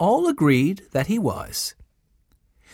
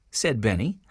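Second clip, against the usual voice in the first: usual speaking voice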